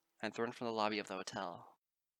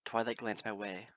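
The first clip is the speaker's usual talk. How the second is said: ordinary delivery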